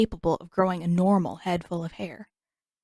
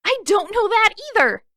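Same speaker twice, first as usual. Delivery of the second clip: much higher and louder, yelling; furious shout; 13 semitones higher, 6 dB louder than usual. A narrator's reading. Katara yelling at the prince in frustration, furious. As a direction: scream it in frustration.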